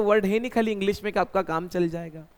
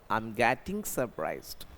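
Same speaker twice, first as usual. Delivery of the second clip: slow, softer, word by word; slow model sentence; 8 semitones lower, 7 dB quieter, much slower than usual. Shown as a demonstration. Teacher models the English sentence slowly. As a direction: spell it out slowly for students. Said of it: "मैं surprise हो रहा हूँ".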